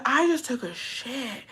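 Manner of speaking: lightly voiced goofy boyfriend. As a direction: tease her with a soft silly voice. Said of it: "he's like".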